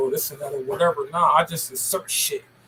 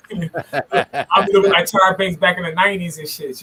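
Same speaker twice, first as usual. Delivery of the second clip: laughs through the words, a bit louder; laughing; 3 semitones higher, 8 dB louder than usual. Real, not acted. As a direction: brag while laughing. Bragging about her model looks.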